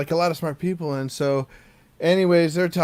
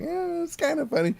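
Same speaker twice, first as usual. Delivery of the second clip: unimpressed; 12 semitones higher, 6 dB quieter than usual. Retold or imitated. Kids unimpressed by dad joke.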